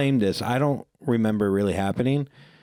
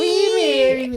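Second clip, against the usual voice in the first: very high, louder squeaky voice through a laugh; Whitey voice; 12 semitones higher, 6 dB louder than usual. Retold or imitated. Reenacting his proposal in a squeaky cartoon voice, laughing. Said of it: "like fucking Whitey from Eight Crazy Nights".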